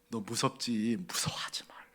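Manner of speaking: quiet, tender reassurance breaking into a whisper; comforting whisper. Acted. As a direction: whisper it like calming a scared child. God comforts fearful Joseph.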